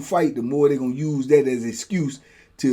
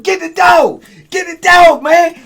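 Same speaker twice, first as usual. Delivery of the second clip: higher, louder desperate yell; desperate shout; 11 dB louder, slower than usual. Retold or imitated. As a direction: scream for them to pull the dog off. Yelling at guards during fight.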